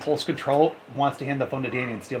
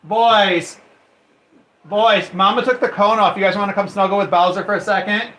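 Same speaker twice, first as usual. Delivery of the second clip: loud, raised call across the house; 5 semitones higher, 6 dB louder than usual. Real, not acted. Mom calls the boys to snuggle with Bowser, loud calling out. Calls sons from another room.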